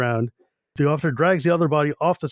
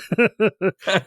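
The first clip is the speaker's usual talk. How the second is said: laugh; 5 semitones higher than usual. Real, not acted. Laugh after joke.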